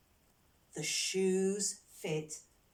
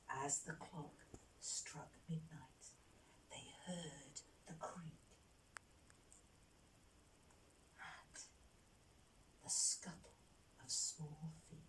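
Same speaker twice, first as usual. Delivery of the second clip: hushed suspense; 3 semitones lower, 12 dB quieter than usual. A narrator's reading. Storyteller as the elves creep in at midnight, hushed. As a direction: creep through it softly, letting each sound hang.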